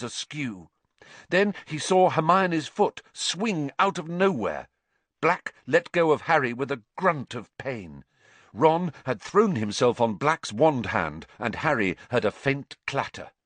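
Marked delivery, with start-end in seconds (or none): none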